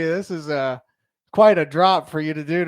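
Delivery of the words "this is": normal talking voice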